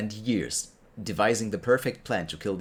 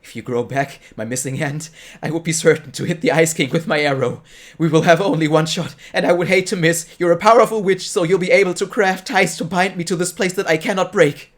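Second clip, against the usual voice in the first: higher and louder, building as he bargains; sly hunter voice; 7 semitones higher, 10 dB louder than usual. Acted. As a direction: hunter pressing his deal, growing bolder. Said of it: "said the Mad Hunter".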